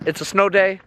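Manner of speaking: ordinary talk, nothing marked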